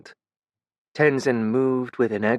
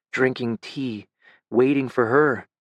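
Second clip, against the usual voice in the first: plain reading voice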